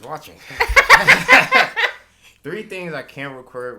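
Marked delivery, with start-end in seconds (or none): 0.4-2.4 loud, high burst of laughter; real laughing fit. Real, not acted. Laughs at who's watching.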